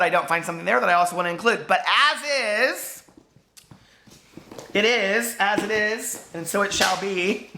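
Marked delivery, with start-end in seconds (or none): 1.7-7.5 slow, higher, solemn decree; mock proclamation. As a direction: pronounce it like a prophecy, very slowly. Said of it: "That sounded crazy".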